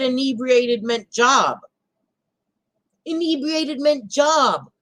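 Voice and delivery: high, loud, drawn-out disbelief; incredulous mocking. Vlogger quoting a woman's botched word meaning, incredulous mocking. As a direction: slowly repeat it as if it's absurd.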